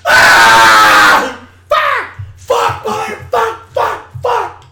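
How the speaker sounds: high, loud repeated screams of fuck; furious screaming. Acted. When work calls on his day off, screaming furious fucks. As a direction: scream it over and over in rage. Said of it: "That's me when my work calls".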